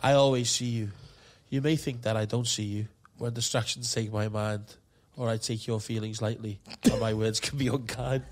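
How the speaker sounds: lightly crooned love poem. Pretend. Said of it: "a love voice".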